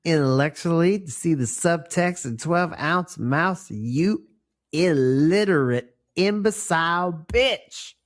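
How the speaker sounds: mock the elitist fan, building; snob rant. Mocking snobbish fan rant.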